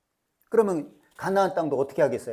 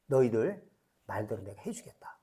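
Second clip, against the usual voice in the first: whispered, low and softer; ominous whisper; 7 semitones lower, 6 dB quieter than usual. Acted. Preacher whispering God's verdict to the congregation, hushed. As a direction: let the verdict sink in a hush.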